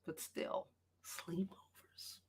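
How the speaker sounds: breathy whispered aside. Real, not acted. Streamer whispering one word to her chat. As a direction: drop to a slow secret whisper.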